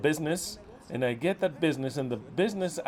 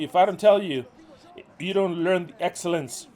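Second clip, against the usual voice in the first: normal talking voice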